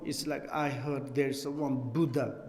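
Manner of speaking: ordinary delivery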